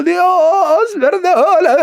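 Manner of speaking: high, loud sobbing plea to God; weeping prayer. Acted. Demon weeping loudly as he begs God's forgiveness. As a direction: cry the words out, voice breaking. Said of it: "Y ya llora".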